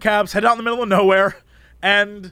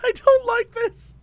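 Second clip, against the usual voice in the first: high, slow squeaky mock whine; 14 semitones higher, slower than usual.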